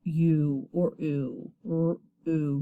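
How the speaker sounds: U or oo drawn out slowly, then r, oo separately; long vowel sound. Shown as a demonstration. Teacher showing the long vowel, slowly sounded out r, oo. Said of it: "it's gonna say it's long vowel sound".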